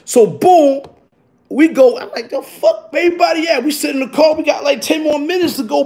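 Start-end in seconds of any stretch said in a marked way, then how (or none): 2.3-3.6 sudden high squeak of disbelief; shocked disbelief. Retold or imitated. Teller finding the empty parking lot, high shocked squeak. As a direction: jump way up in pitch, baffled.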